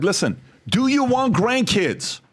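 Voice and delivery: high-pitched challenge to a father; retold argument. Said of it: "One of the biggest fights I ever had".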